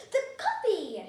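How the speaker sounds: loud, high exclamation of remembering; startled cry at something forgotten; sudden alarm. A narrator's reading. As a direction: blurt it out as it hits you.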